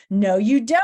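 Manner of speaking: loud defiant retort; defiant thought. Acted. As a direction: call it out to stop someone fleeing. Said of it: "he thought".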